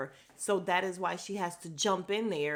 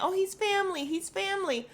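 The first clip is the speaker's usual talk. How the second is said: mock gushing; 12 semitones higher, 3 dB louder than usual. Mocking her overeager family claim.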